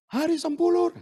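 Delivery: loud, high call across a waiting room; calling out a name. Retold or imitated. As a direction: summon the patient from the desk. Nurse calling a patient's name.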